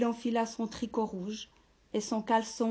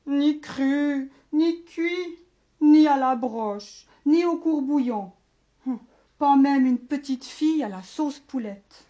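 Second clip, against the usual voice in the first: louder, higher ogre grumble; ogre complaining; 4 semitones higher, 7 dB louder than usual. Acted. Hungry ogre complaining to doctor, loud and aggrieved. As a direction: list every lost dish with outrage. Ogre listing lost meals.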